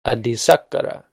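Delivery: "Adisakara" with a strong sak; stress on SAK. Shown as a demonstration. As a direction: lean on sak in "Adisakara", ka-ra light. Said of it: "stress goes on sak".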